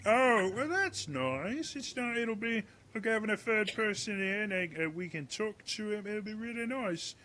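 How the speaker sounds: put-on high voice of a timid young guard; nervous Gareth. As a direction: chirp it out nervously, hoping to please.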